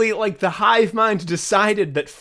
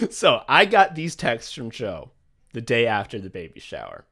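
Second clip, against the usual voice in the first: usual speaking voice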